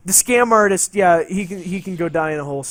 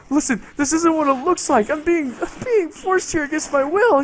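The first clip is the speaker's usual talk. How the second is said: higher, pleading protest; pleading excuse; 9 semitones higher than usual. Retold or imitated. Pleading with his jealous roleplay wife, higher mock protest. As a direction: beg her to believe you, pitched up. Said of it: "And I'm like".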